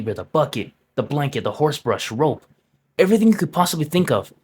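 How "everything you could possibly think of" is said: excited burst. Real, not acted. Excited listing of inventory.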